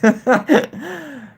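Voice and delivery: high laugh with a drawn-out tail. Real, not acted. Streamer laughing with viewers about the troll, high laugh. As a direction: break into a real, high laugh.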